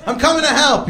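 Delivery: high-pitched, excited volunteering; eager neighbour. Retold or imitated. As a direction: jump in, thrilled to pitch in. Neighbours eagerly join the cleanup.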